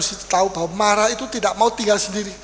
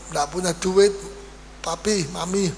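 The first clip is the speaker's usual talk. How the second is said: usual speaking voice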